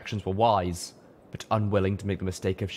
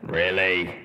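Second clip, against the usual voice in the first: rumbled; 4 dB louder than usual. A narrator's reading. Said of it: "Steelhooves rumbled".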